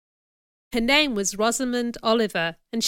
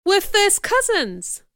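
amazed realisation; 10 semitones higher, 4 dB louder, slower than usual. A narrator's reading. Jane repeats the news.

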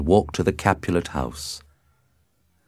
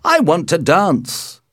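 high, loud and slow; raring to go, loud and high; impatient cry; 11 semitones higher, 6 dB louder than usual. A narrator's reading. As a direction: call it out eagerly, drawing it out. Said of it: "he cried".

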